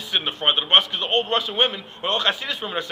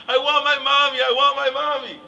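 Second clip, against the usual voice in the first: high, loud imitation of a screaming baby; mock crying scream; 7 semitones higher, 6 dB louder than usual. Baby boy begging father for mommy, mock crying scream. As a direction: wail it like a tantruming little kid. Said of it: "the baby starts crying and screaming".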